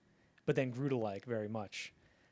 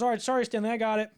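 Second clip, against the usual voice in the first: higher, louder hasty apology; apologetic crew; 9 semitones higher, 9 dB louder, faster than usual. Retold or imitated. Sound man apologising to the director, high hasty apology. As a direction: rush out the sorry, pitched up.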